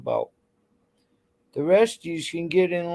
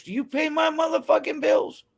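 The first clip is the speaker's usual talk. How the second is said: higher, raised defiant demand; a shouted comeback, pitched up; mock shout; 7 semitones higher than usual. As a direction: throw it back at them like a challenge. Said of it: "the old saying goes".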